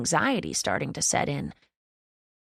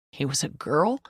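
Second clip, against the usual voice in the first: put-on disbelieving, rising on girl; surprised question. A narrator's reading. Dad stunned the dog was a girl, surprised. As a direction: ask it in disbelief. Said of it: "he repeats".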